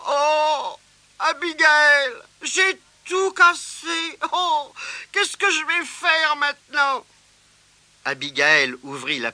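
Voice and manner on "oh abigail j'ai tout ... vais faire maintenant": high, wailing little voice; dismayed. Acted. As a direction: lament the broken box. Boy broke the decorations.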